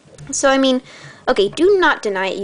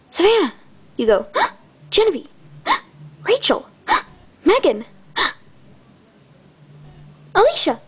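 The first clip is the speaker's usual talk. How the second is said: gasping recognition; 9 semitones higher than usual. Pretend. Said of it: "you're like".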